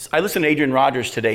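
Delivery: ordinary talk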